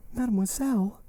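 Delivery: high-pitched indignant echo; Valentine's cry. A narrator's reading. Valentine echoing Morrel's formal address, indignant cry. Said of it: "cried Valentine".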